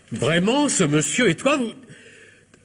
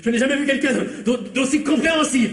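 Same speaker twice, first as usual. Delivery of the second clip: nervous gushing thanks; 9 semitones higher than usual. Acted. Nervous thanks to the armed doctor.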